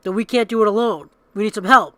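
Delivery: lightly hammed-up call for help; mock plea for help. To the crowd, mock plea for help. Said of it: "She's like".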